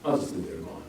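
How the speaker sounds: ordinary talk, nothing marked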